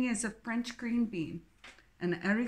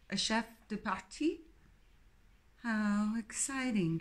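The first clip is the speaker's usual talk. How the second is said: sarcastic; 3 semitones higher than usual. Acted. Jane's sarcastic reply.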